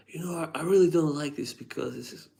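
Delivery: high, fast mock-grumpy reviewer; imitating reviewer. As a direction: parody a fussy critic sniffing perfume. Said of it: "he was just angry about Aventus".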